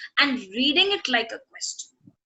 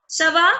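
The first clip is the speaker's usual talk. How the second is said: "Ça va" louder, lifted into a question; questioning intonation; 3 semitones higher, 5 dB louder than usual. Shown as a demonstration. Teacher testing whether students hear a question, questioning intonation. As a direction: ask "Ça va" with the voice going up on "va". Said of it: "Une question".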